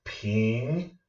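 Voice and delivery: "ping" sliding steadily upward; second tone. Shown as a demonstration. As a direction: let the voice rise through "ping". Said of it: "our voice rises".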